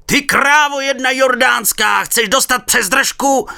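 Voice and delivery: slow loud high threatening yell; firemen yelling threats. A narrator's reading. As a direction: threaten them at full voice. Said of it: "volali hasiči".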